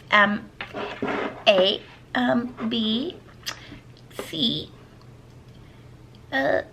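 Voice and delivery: put-on baby voice. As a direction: sound out each item like a tiny child. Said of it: "my baby voice".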